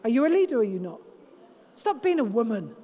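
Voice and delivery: low, unemotional husband voice; imitating a husband's blunt telling-off; husband cutting in. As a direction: drop low, cut through the fuss. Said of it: "he cuts it out. He says".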